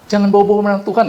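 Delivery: quick, brushing-off mock retort; dismissive retort. Preacher mimics a scoffer dismissing talk of God, fast and dismissive. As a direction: toss it off fast like waving him away.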